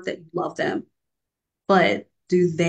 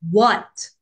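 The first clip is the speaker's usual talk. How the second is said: irritated what; 3 semitones higher, 5 dB louder than usual. Retold or imitated. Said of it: "Because I'm irritated".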